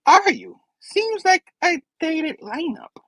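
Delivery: high-pitched mocking comeback, drawn out; Goblin Spidey. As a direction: needle them slowly in a high voice. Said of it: "He's like".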